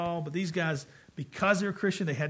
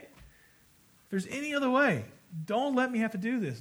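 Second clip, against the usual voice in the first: desperate plea; 6 semitones higher, 3 dB louder than usual. Pretend. Voicing a desperate prayer.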